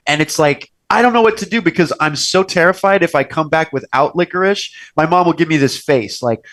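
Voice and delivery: ordinary delivery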